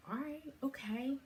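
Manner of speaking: high, startled all right okay; a quick, high double take; surprised okay. Retold or imitated. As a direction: react with a jolt of surprise at the number. Surprised at the scale number.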